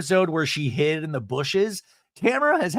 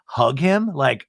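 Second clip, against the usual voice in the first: ordinary talk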